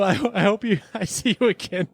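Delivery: normal talking voice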